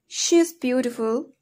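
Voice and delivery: "she's" as one linked syllable; weak form of IS. Shown as a demonstration. Teacher showing learners weak forms, "she's" contracted. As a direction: merge "is" into "she's". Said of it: "in a weak form we pronounced".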